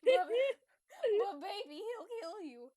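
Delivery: hammed-up plea, high and drawn out; protesting. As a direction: stammer a slow, loud mock protest. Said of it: "I protest".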